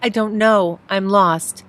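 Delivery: pleading, lost and scared; frightened. Acted. Lost woman calling husband.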